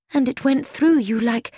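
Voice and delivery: usual speaking voice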